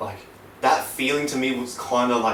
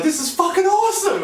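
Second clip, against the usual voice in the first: very high, louder delighted squeal; elated; 4 dB louder than usual. Retold or imitated. Remembering the thrill of the freefall, a very high elated squeal. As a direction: burst out with thrilled amazement. Sudden joy while skydiving.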